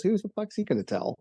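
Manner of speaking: high, casual quoted retort; mock spec ops guy. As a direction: toss it off like it's obvious. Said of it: "He goes".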